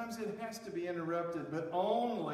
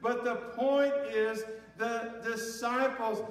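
higher, louder, driving preacher build; full-force preaching; 8 semitones higher, 6 dB louder than usual. Acted. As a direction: preach it hard, pushing the point home.

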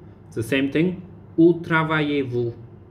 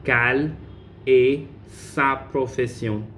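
slow split model. Shown as a demonstration. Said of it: "teacher repeats slowly".

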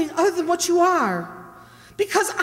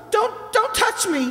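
higher, slow, pleading refusal; frightened; 4 semitones higher, slower than usual. Acted. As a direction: keep him off, terrified. Wife recoils from husband.

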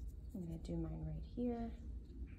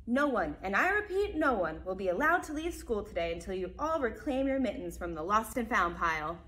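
principal announcing; 2 semitones higher, 7 dB louder than usual. Acted.

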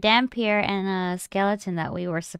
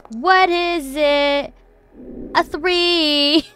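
drawn-out reveal; 6 semitones higher, 7 dB louder, much slower than usual. Real, not acted. Player reveals bad dice roll.

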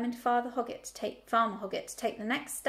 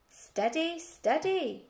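calling out; 5 semitones higher, much slower than usual. Acted. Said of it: "called the farmer".

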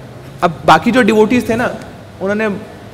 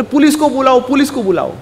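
high-pitched urgent order, retold; urgent call; 6 semitones higher than usual. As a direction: call out high for help, without shouting. Guru urgently calls for police.

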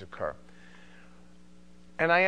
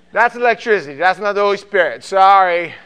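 loud, higher-pitched burst; loud exclamation; 4 semitones higher, 15 dB louder than usual. Real, not acted. Pastor jokes about flickering lights, loud exclamation. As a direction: belt it out over the laughter.

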